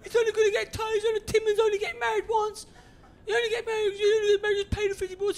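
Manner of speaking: high, whining mock-tearful voice; pleading friend. Friend begging him to pay for the wedding trip, mock-tearful pleading. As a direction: beg in a put-on squeal.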